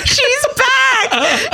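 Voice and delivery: high laughing call; laughing shout. Real, not acted. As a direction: burst out laughing as you say it. Laughing echo of friend.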